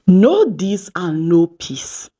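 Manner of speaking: Know this and know peace, each word drawn out slowly; a deliberate, weighty closing line; slow emphatic motto. Real, not acted. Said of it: "slow emphatic motto".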